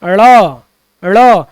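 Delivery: ड़ as a flapped retroflex, held long; drawn-out flapped ड़; letter sound demo. Shown as a demonstration. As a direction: flip the tongue for ड़ and let the vowel ring. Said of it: "ये हो जाएगा ड़".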